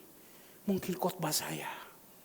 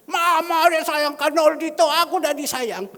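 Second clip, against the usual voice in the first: put-on child's voice; 11 semitones higher, 11 dB louder than usual. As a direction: whine it like a jealous little kid. Preacher voices a jealous child. (suara anak kecil yang merengek)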